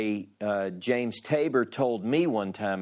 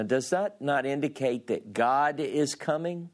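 normal talking voice

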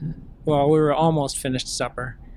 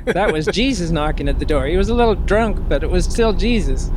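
louder and higher, building excitement; excited; 4 semitones higher, 5 dB louder than usual. Real, not acted. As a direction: punch the funny line out with glee. Punchline of a family story.

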